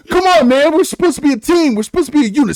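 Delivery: high, loud imitated appeal; mock exasperated plea. Quoting a film to a friend, pleading with the team. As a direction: plead in a hammed-up film quote.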